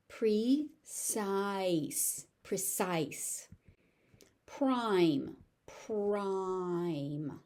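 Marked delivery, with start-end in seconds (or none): 0.1-2.3 "Precise" stretched into two separate syllables. Shown as a demonstration. Teacher breaks word into syllables. 5.7-7.4 "Prime" stretched long on the vowel; slow-motion model of "Prime"; drawn out. Shown as a demonstration. Teacher stretches word for learners.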